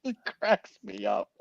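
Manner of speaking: amused, breaking into a high laugh; real laughter. Real, not acted. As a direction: crack up as you finish talking. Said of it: "I'm still laughing at the idea".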